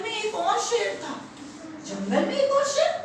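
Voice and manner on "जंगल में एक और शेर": high, rising echo of disbelief; incredulous question. Acted. Lion hears of a rival in his jungle, incredulous. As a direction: repeat it climbing sharply at the end.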